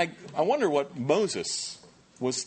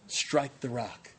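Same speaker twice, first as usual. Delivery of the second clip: slow solemn line; 4 dB quieter, much slower than usual. Real, not acted.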